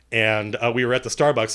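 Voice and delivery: normal talking voice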